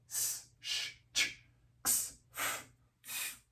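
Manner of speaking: whispered consonant sounds; voiceless end sounds. Shown as a demonstration. Teacher whispering the voiceless sounds S, Sh, Ch, X, F. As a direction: breathe out each sound without voice. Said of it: "How can you know if a verb sounds like T".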